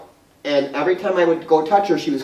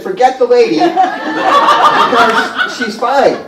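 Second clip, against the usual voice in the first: raised, louder retort through laughter; mock dismissive; 8 semitones higher, 7 dB louder, much slower than usual. Mother waving off her daughter's worry about the client, mock dismissive. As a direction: imitate an exasperated brush-off, drawing out the end.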